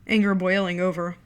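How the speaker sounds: plain reading voice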